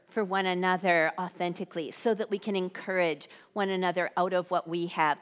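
usual speaking voice

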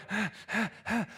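short huffs into a strained roar; straining lift effort. Acted. Lifter pushing up the record weight, straining roar. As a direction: puff hard, then heave with effort. Acts straining under the bar.

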